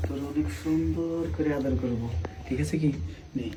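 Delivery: soft sly murmur; hushed coaxing. Acted. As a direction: coax quietly so no one hears. Coaxing sister-in-law in secret.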